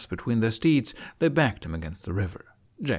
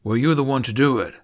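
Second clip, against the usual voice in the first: demanding; 3 semitones higher, 7 dB louder, faster than usual. A narrator's reading. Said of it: "Spiteloud demanded".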